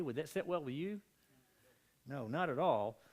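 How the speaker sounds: ordinary delivery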